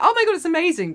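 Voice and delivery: high, gushing wonder; acted amazement. Co-host trying to focus on the magic, acted amazement. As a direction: gush it in imagined delight. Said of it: "I want to just focus on that".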